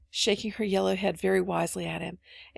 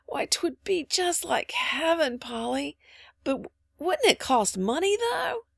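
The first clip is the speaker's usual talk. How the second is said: put-on higher, drawn-out little-boy wonder; wistful little boy; 8 semitones higher, slower than usual. A narrator's reading. Little Davy dreaming aloud to Polly, wistful wonder. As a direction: marvel slowly, then lift into a doubtful question.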